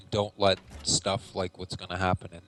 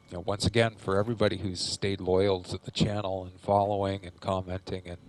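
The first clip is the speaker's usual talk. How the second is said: ordinary talk, nothing marked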